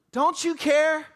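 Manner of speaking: "care" flung out loud and high; panicked cry. Acted. Frightened disciples begging Jesus in the storm, panicked cry.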